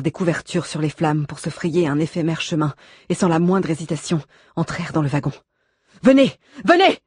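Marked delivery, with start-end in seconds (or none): 6.0-7.0 urgent shout. A narrator's reading. Rescuers shouting into burning wagon.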